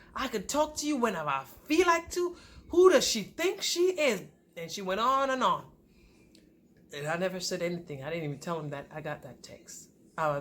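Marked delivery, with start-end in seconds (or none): 0.1-4.2 pitched-up sassy imitation; mocking retold rant. Ex ranting about the wife on the phone, mock indignant. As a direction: mimic an affronted rival.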